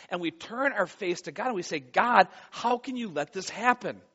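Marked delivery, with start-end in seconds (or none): 1.8-4.0 raised, pleading cry to God. Acted. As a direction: build to a loud, drawn-out appeal. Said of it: "And we cry out for him".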